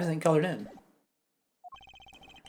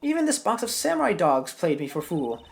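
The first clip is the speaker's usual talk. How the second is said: put-on higher-pitched scolding old woman; cranky old lady; 5 semitones higher than usual. Old lady halting young prosecutors, cranky old woman. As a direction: bark it like a grumpy granny.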